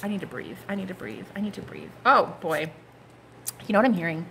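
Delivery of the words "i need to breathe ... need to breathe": quiet. Real, not acted. Overwhelmed, repeats softly.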